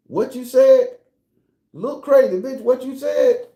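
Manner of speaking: loud, high-pitched challenge; confrontational challenge. Acted. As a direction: fire it back high and loud. Acts out devil-wife confrontation.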